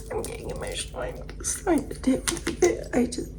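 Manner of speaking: high, tearful squeak, breaking off; getting emotional. Pretend. Stylist telling the client it's just really hard, mock tearful.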